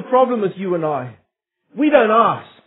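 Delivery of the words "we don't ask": firm, fairly loud preacher's point; emphatic statement. Real, not acted. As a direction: drive the point home to the congregation. Emphatic first take, then repeated softer.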